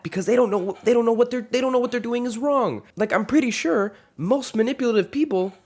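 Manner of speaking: high, worked-up rant; excited rant. Real, not acted. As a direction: get fired up about it.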